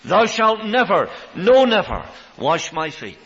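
slow, loud "never, no, never"; strong refusal. Acted. Peter refusing Jesus, loud and slow. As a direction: hammer the refusal home. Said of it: "That's a very strong word".

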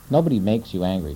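usual speaking voice

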